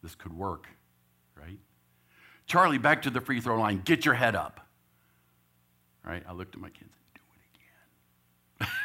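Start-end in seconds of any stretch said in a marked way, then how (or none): 2.5-4.6 loud call across the court; coach calling out. Retold or imitated. Coach yelling across the gym to his small player, loud. 7.1-8.7 slow, secret whisper; coach whispering. Retold or imitated. Coach sneaking an order to his kids, slow whisper.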